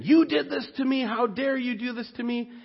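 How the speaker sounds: high-pitched accusation, acted out without full volume; hammed-up outrage, pitched well up, softening on the second sentence; mock angry yelling. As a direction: imitate someone railing at God. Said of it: "he's yelling at him and saying".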